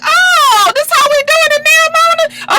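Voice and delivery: loud, high-pitched disbelief; hammed-up squeal of disbelief; mock shock. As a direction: exclaim in exaggerated surprise. Retells own shocked reaction.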